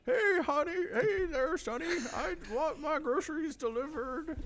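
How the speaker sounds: put-on high, quavering very old man. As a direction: play a creaky old man calling the store. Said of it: "you can do an old man voice very, pretty well".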